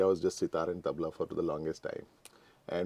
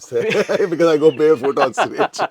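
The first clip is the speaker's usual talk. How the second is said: laughing through a joke; 9 semitones higher, 16 dB louder than usual. Real, not acted. Musician joking to host about going barefoot, laughing. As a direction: chuckle out the punchline, much higher and louder.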